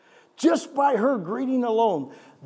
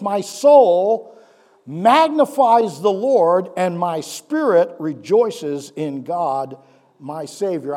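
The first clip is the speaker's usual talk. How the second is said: louder, slow proclamation; proclaiming Mary's song; 8 dB louder, slower than usual. Acted. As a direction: declare it slowly and fully.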